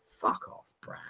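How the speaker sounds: very soft, voiceless aside; hushed, fed-up brush-off; muttered dismissal. Acted. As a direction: mutter it under your breath. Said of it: "everybody's just like".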